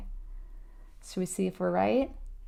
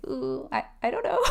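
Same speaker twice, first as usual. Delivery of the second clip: rising higher into a nervous laugh; flustered laughing reply; 7 semitones higher than usual. Pretend. Student put on the spot by a judgy question, nervous laugh. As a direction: caught off guard, laugh it away. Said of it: "You're gonna say".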